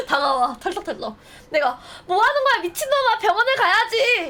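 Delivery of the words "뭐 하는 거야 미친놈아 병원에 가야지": much higher, louder, a pleading sobbing yell; crying shout. Pretend. To the bloodied hero who came anyway, a crying shout. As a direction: scream at him through tears. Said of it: "내가 울면서".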